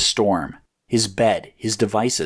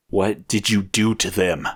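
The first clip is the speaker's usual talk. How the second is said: demanding. A narrator's reading. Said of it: "he demanded".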